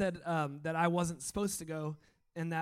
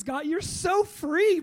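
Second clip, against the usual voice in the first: higher and much louder, overjoyed praise; excited cheering; 12 semitones higher, 12 dB louder, much slower than usual. Real, not acted. Pastor cheering on a freed worshipper, thrilled and loud. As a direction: cheer him on as he breaks free.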